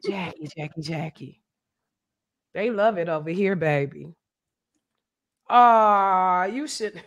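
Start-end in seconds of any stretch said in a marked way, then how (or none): 0.0-1.4 low, soft churchy impression; Evangelist Val voice. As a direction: call her name like a gentle preacher lady.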